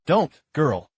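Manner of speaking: loud, sharp cry to stop her; urgent shout. Acted. Stopping her from self-harm.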